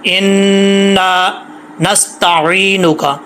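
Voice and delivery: slow recitation with a clear ain in नस्तईनुका. Shown as a demonstration. Teacher reciting the dua for students, slow. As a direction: draw out every syllable.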